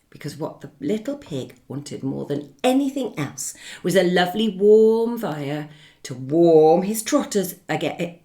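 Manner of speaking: usual speaking voice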